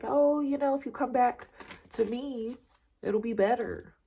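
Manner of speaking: a high, coaxing imitation of a manipulative ex; mock the smooth talker luring you back; sweet-talking ex. Reader mimics the ex whispering lies into your ear, coaxing and high.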